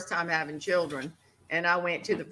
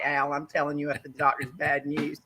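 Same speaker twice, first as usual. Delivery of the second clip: ordinary delivery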